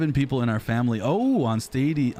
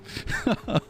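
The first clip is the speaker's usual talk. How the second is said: short, high, breathy burst of laughter; quick high chuckle at himself; amused laugh; 12 semitones higher, 3 dB quieter than usual. Real, not acted. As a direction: laugh it off after your own joke. Joking about a sad stream.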